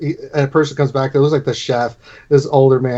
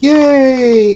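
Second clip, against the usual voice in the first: high, loud gleeful yay; child's cheer; 12 semitones higher, 7 dB louder than usual. Acted. Little girl cheering that they can eat, excited. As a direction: squeal with a kid's delight.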